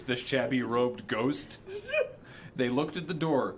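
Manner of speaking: normal talking voice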